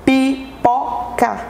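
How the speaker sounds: "pipoca" slowly in three pieces, pi, po, ca; syllable by syllable. Shown as a demonstration. Teacher showing three little pieces, "pipoca" split syllable by syllable. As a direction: separate each syllable of "pipoca" with a short pause. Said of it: "nós temos aí três pedacinhos".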